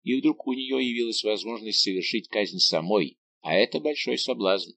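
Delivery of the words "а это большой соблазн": ordinary delivery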